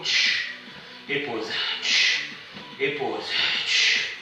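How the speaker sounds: softly exhaled pause cues; breathy exercise cue. Real, not acted. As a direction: breathe the word out. Cueing breaths during exercise.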